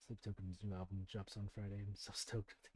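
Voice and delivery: soft, low muttered aside; quiet aside. Acted. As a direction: murmur it to yourself.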